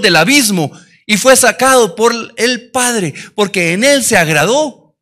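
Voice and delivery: loud, rising sermon delivery; loud passionate preacher; fervent preaching. Acted. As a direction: preach it out with full conviction. Preaching at full force.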